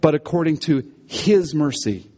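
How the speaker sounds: pause, then stress "his"; emphasized "his". Shown as a demonstration. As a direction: set "his" apart and lean on it. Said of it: "in there is emphasized".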